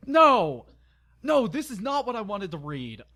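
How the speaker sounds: loud, high wail of protest; exasperated outburst. Real, not acted. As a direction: burst out in horrified disbelief, then ease slightly. Reader breaks off in protest.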